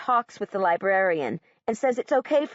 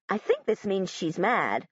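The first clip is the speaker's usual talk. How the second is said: plain reading voice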